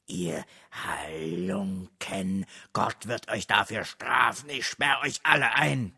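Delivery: helpless, drawn-out stammer building in pitch; hilfloses Stammeln. A narrator's reading. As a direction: start slow and helpless, rise into a feeble threat. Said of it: "nur noch hilfloses Stammeln". (hilflos stammelnd, langsam ansteigend)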